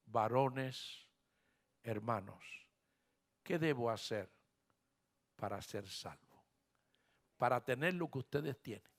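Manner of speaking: very soft, low entreaty; hushed jailer begging on his knees; humble plea. Acted. Trembling jailer kneels before Paul.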